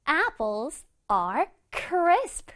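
"Apples are crisp" very slowly, word by word; slow model sentence. Shown as a demonstration. Teacher reading the example sentence slowly to kids. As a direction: spell each word out slowly for the class. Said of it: "자, 그럼 문장에서 볼게요".

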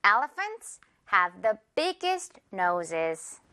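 Elephants have the biggest noses, word by word, very slowly; slow classroom model sentence; very slow demo. Shown as a demonstration. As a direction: model each F slowly for repeating. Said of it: "F 발음 갑니다".

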